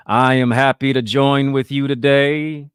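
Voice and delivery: melodic, poetic vibrato, boomed out slowly; Martin Luther King oratory. Acted. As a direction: imitate a great Southern Baptist speech, drawn out and loud. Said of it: "talk in that low, melodic, poetic vibrato".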